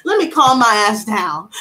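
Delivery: ordinary talk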